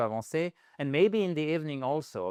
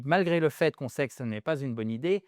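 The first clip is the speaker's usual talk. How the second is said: ordinary talk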